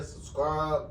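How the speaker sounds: ordinary delivery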